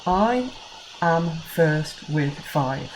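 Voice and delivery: "I am first with five" spoken slowly, one beat per word; reading out a haiku line and counting its beats. Shown as a demonstration. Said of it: "that line has five syllables".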